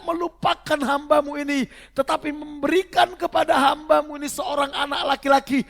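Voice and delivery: high, shouted declamation of a vow; impassioned, sustained scripture shout; full-force preaching. Acted. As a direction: cry the prayer out to heaven at full volume.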